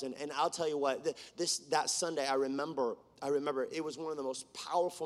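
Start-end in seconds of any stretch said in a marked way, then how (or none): none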